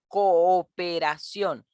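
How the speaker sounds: "Cooperación" drawn out slowly, co-o-pe-ra-ción; slow teacher's "Cooperación", the double o held apart; syllables separated. Shown as a demonstration. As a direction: give both o's of "Cooperación" their own beat. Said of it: "que se separan de sílabas".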